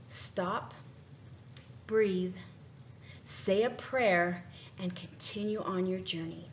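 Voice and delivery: slow soft advice. Shown as a demonstration. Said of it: "motivational advice delivered slowly".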